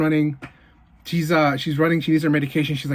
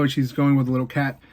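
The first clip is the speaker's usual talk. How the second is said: ordinary talk, nothing marked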